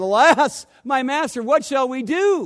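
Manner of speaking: loud, high, panicked plea; servant's frightened cry. Acted. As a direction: cry out to the master in alarm.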